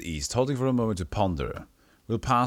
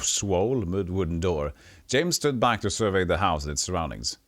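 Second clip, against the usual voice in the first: ordinary delivery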